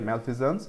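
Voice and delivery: Malfeasance as "mel-fee-zuns", the z sound clear; teacher repeats Malfeasance: mel-fee-zuns; correct pronunciation. Shown as a demonstration. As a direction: model pronunciation of Malfeasance for students. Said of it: "ऐसे pronounce करो".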